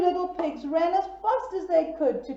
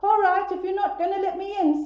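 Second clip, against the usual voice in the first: bad wolf threat; 7 semitones higher, 4 dB louder than usual. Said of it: "said the bad wolf".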